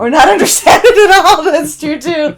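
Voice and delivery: laughing through the words; giggling it out. Real, not acted. Laughing reply.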